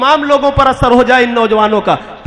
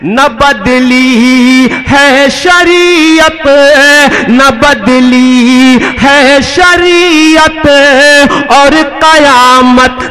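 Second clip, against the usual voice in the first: measured, drawn-out poetic recitation; stately nazm recitation; 3 semitones higher, 5 dB louder, much slower than usual. Acted. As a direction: declaim each line with weight. Recites quatrain to audience.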